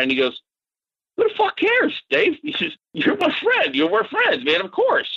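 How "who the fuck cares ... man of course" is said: much higher, brushing the worry aside; a high, incredulous "of course we're friends" reply; incredulous friendly outburst. Retold or imitated. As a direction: laugh off the idea that being the bad guy matters. Bob brushes off the bad-guy worry.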